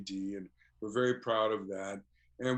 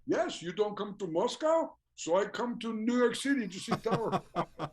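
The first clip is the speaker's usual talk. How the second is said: higher Russian fan voice through laughter; imitating the Russian fan, laughing; 10 semitones higher than usual. Fan explaining he came from Moscow, imitated through laughter. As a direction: play the earnest fan while cracking up.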